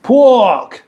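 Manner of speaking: loud, high Cockney "pork"; Cockney accent. Shown as a demonstration. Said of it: "Is it a Cockney thing?".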